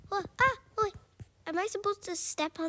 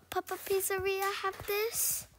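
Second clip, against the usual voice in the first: ordinary delivery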